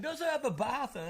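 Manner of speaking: put-on high-pitched questioning English accent; hammed-up English accent; mock English accent. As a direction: imitate a baffled, argumentative Englishman. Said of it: "He's like".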